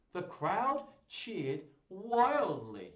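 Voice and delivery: loud, slow, stretched excitement; dramatic narration. A narrator's reading. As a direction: draw out each word with relish. Narrating the cheering crowd.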